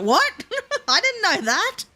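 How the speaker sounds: high-pitched, laughing mock shock; amused disbelief. Streamer recalling learning a game trick, amused disbelief. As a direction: react in high, giggly disbelief. Retells own shocked reaction.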